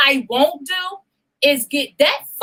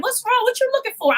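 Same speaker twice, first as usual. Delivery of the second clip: friends asking; 12 semitones higher, faster than usual. Retold or imitated. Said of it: "everybody's like".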